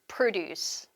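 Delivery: produce stressed on PRO; first-syllable stress. Shown as a demonstration. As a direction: model produce as PRO-duce. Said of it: "액센트가 일강세로 바뀌게 됩니다".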